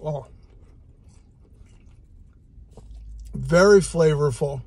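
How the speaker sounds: talking while chewing; muffled by food; mouth full of pizza. Real, not acted. Seen 0: Bites slice at 7.6, chews through the final "Oh.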